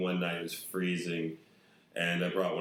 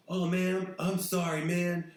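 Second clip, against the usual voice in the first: apologetic stranger; 10 semitones higher, 3 dB louder, slower than usual. Retold or imitated. Voicing the peeing guy apologising.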